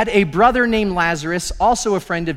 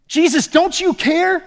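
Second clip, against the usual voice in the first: much higher, slow, pained demand; exasperated cry; 10 semitones higher, 3 dB louder than usual. Acted. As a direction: throw the question up at him. Martha's blunt question, not prim.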